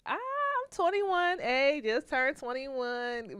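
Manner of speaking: high, slow and proud; beaming about the birthday; a little excited. Retold or imitated. To the parole board, proudly telling her age, excited. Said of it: "So I was a little excited".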